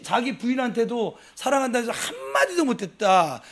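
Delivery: normal talking voice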